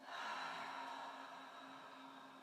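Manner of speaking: long, soft exhaled sigh; deep letting-go exhale; demonstrated sigh. Shown as a demonstration. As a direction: breathe out slowly in a voiceless sigh. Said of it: "Maybe another nice deep inhale and exhale, sigh".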